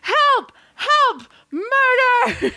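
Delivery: high shouted cries out the window; screaming for help. Acted. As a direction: scream for the police. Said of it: "starts just screaming".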